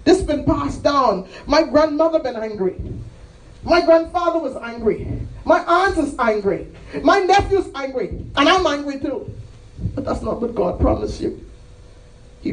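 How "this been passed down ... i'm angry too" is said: high, raised preaching cadence; impassioned testimony. Acted. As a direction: call out each relative in a raised voice. Acts out confession of family anger.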